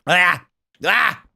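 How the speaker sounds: short loud startled cry; startled yelp. Real, not acted. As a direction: yelp as if jumped. Creeper ambush scream.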